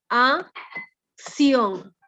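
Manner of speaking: acción broken into ac, pause, ción; syllable by syllable. Shown as a demonstration. Teacher counting two syllables, acción split as ac... ción. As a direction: say acción in two separate drawn-out beats.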